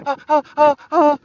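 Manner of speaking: high panicky stammering; mock flustered host. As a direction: flounder in fake fear. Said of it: "to being".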